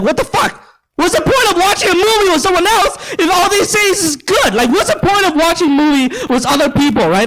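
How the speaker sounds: mock outraged reply to a bland date; exasperated disbelief. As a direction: throw up your hands in disbelief. Exasperated retold reaction.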